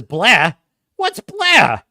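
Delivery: normal talking voice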